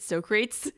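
"Socrates" said as "so crates"; mispronounced Socrates. Retold or imitated. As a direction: say "Socrates" in two syllables, rhyming with "no dates". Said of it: "they call him Socrates".

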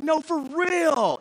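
high, drawn-out half-shout; excited insistence. Retold or imitated. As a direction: stretch it out, pleading to be believed. Insisting to team that healing works.

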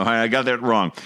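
usual speaking voice

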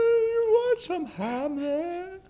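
high, slow spooky ghost. Pretend. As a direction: drag it out like a ghost. Ghost voice again.

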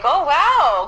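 bright, amazed "oh wow"; impressed wow. Retold or imitated. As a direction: sound genuinely impressed. Retells her own impressed reaction.

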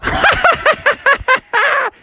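very high smug cartoon laugh; gloating high laugh. Mom laughing at Froggy's zone, very high gloating laugh. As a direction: cackle up high like the teasing mom.

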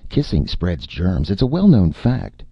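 nervous. A narrator's reading. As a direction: insist anxiously, pitch rising. Martin nervously dodges a kiss.

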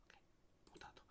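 hushed whisper; shh, whisper it low. Real, not acted. Hushing while audio is muted.

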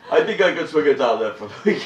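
loud, put-on politician impression; Bernie. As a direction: do a comic impression of the Vermont senator, loudly. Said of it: "quite an excellent Bernie impression".

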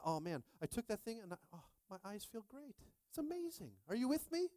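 high, thrilled gushing; mock relief. Retelling how great his eyes felt, high and thrilled. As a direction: gush about the cure, voice soaring.

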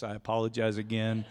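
normal talking voice

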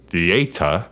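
"theater" low, as thee-AY-tuh; mock grand "theater", stressed on AY; overenunciated theater. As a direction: say "theater" in three syllables, dropping the final R. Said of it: "as you say it".

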